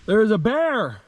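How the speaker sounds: excited. Real, not acted. Excited report of the bear.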